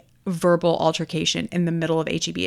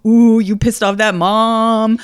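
loud, drawn-out mock taunt; slow, loud retold tease; imitated teasing; 3 semitones higher, 7 dB louder, slower than usual. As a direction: stretch it out like a gleeful ooh-you're-in-trouble. Husband teasing her, retold.

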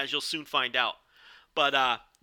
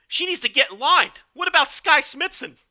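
much higher, louder annoyed East Coast guy; Gideon Gray impression; 12 semitones higher, 7 dB louder than usual. Commentator fed up with a new contender, loud annoyed East Coast voice. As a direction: bark it like a road-raging East Coast driver, high and loud. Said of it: "That was a horrible, uh, Lord Gideon Gray impression".

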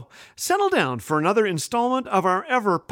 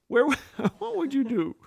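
laughing; 6 semitones higher, 4 dB quieter than usual. Real, not acted. Laughing at own blooper.

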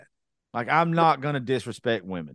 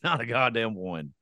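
ordinary delivery

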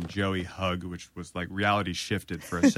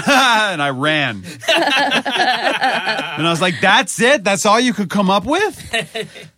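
high, loud, laughing all through; laughing self-mockery; 13 semitones higher, 11 dB louder than usual. Real, not acted. Laughing about fleeing from Joey, high and loud. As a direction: cringe at your younger self with a laugh.

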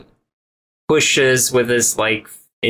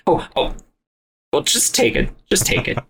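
flustered giving up; 8 semitones higher, faster than usual. Acted. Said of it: "and is like".

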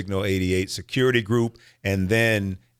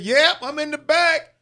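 higher, slower call from the back of the room; voicing Gary in the crowd; 3 dB louder than usual. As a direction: answer from the back like a small-town audience guy. Audience member Gary answers.

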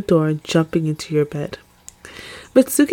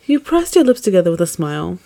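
ordinary delivery

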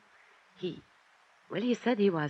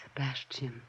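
whispered in shock, much softer; a shocked whisper on hearing of the murder; stunned whisper; 3 semitones lower, 10 dB quieter than usual. Acted. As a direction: breathe the name in disbelief.

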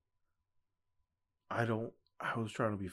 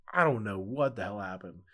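ordinary delivery

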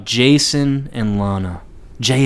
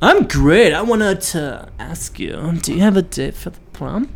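slow, high, dreamy mother; dreamy mom voice; 5 semitones higher than usual. Retold or imitated. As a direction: float the words like a spaced-out mom. Voicing stoned Martha to Clark.

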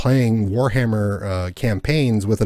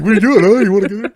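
much higher and louder taunt; mock taunting gun; 11 semitones higher, 8 dB louder, faster than usual. Friend retelling the in-game gun, high jeering taunt. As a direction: jeer like a bully blocking the way.

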